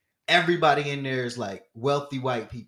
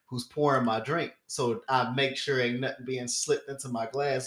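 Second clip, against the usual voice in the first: ordinary delivery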